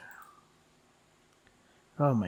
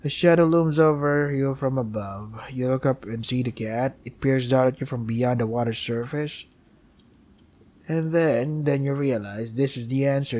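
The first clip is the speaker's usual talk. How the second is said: steady storyteller reading of the game text; game story narration; 5 dB louder than usual. Acted. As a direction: narrate it like a dark fable.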